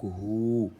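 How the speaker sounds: « hu » avec le ou tenu; voyelle ou allongée. Shown as a demonstration. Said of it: "petit waw qui sert d'outil d'allongement". (« hu » prononcé avec un ou long)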